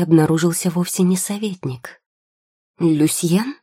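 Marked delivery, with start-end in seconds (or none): 2.8-3.6 удивлённый вопрос с резким подъёмом тона; воскликнуть с удивлением, голос вверх; удивлённое восклицание. A narrator's reading. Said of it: "удивлённо воскликнула девушка". (удивлённо, вопросительно)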